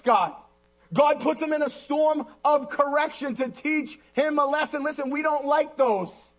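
high, forceful pulpit voice; impassioned preaching. Real, not acted. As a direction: cry out the psalm to the congregation.

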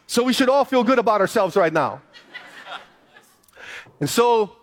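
ordinary talk

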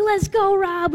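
high, loud cheer from the sidelines; cheering shout. Retold or imitated. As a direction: whoop it up for the rider going by. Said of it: "We're like".

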